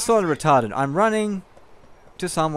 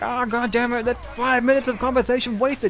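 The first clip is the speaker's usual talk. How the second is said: mock frustration; 8 semitones higher than usual. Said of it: "Just angry".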